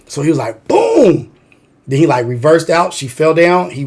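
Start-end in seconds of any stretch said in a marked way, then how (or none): 0.7-1.3 high, falling "boom" sound effect; high-pitched impact noise; imitated boom. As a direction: drop a big boom from up high.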